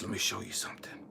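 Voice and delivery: hushed, low voice of God; God speaking. Retold or imitated. God inviting Elijah to see something, hushed. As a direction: murmur low, almost whispered. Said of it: "And God says".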